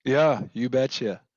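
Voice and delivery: slow Minnesota "you betcha"; Minnesota accent. Pretend. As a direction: drawl it out Minnesota style. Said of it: "You sounded Minnesotan there so hard".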